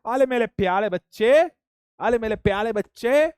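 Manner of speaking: अरे मेरे प्यारे बच्चे cooed with l for r, ale mele pjale; teasing a confused student in lisping baby talk. Pretend. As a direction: lisp it like cooing at a toddler. Teacher coos at pupil.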